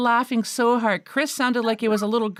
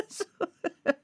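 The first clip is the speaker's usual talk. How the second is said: laughing; 8 semitones higher, 7 dB quieter than usual. Real, not acted. Laughing at frozen screen.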